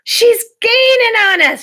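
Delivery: high, loud, slow sailor's shout. Acted. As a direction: yell the warning across the deck. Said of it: "shouted Mountain Jim".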